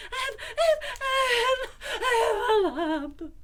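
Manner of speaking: loud high gasping cries; breathless panic. A narrator's reading. Piglet tells Christopher Robin, loud high breathless cries. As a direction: puff out each word in panic. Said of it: "breathing so hard that he could hardly speak".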